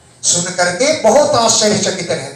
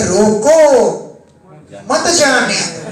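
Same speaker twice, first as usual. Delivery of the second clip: रोको raised as a command, long pause, then मत जाने दो; pause after रोको. Shown as a demonstration. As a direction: break firmly after रोको, then say मत जाने दो as one phrase. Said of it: "समझने में फेर हो गया, मैंने लिखा है".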